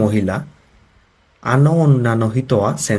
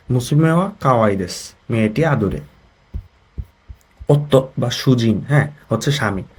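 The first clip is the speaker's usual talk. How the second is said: ordinary delivery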